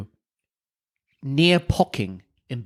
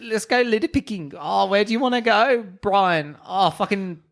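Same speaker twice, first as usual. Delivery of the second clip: high, lively put-on New Zealand accent; mock Kiwi accent; 7 semitones higher than usual. Mocking Kiwi tourists in a put-on New Zealand accent. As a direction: ham up a Kiwi bloke planning a trip.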